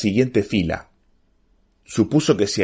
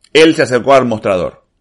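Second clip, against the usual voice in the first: ordinary delivery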